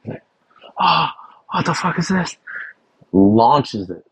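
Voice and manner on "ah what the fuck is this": high-pitched, exasperated outburst; mock outraged chef. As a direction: imitate a chef blowing up. Chef angrily flinging the spatula.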